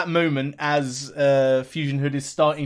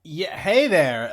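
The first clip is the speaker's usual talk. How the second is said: usual speaking voice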